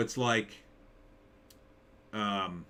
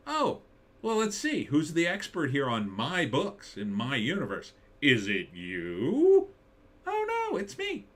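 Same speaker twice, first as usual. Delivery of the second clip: sarcastic high sing-song gloat, drawn out on "is it you"; mock gloating; 5 semitones higher than usual. Creator mocking a fan who claims expertise, smug sing-song. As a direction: taunt the critic with a smug high voice.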